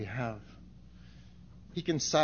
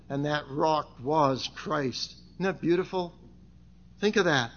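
ordinary talk, nothing marked

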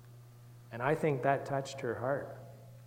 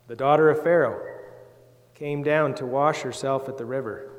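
put-on measured Bible reading for children; scripture reading; 7 dB louder than usual. Reads the Bible aloud to children.

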